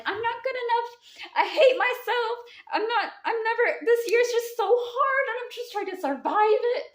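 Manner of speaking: high, whiny voice of a struggling person; whiny self-doubt. Retold or imitated. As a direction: mimic someone who feels like they're failing. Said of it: "So you coming over here being like".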